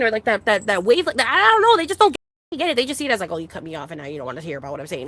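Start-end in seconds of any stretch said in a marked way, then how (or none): 3.3-5.1 low, quiet, rushed quote of a complainer; quick muted mock-complaint; mimicked complaint. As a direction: mimic their grumble under your breath, quickly. Voicing the other person's complaint.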